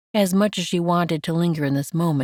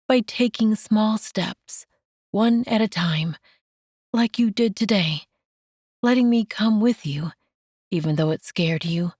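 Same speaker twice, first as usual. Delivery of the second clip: lightly raised, tender woman's voice; gentle Mandy; 5 semitones higher than usual. Acted. Mandy comforting Enoch, lightly raised and tender.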